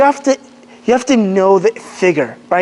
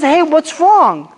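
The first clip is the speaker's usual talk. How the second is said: gentle concern; 4 semitones higher, much slower than usual. Retold or imitated. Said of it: "he goes and he says".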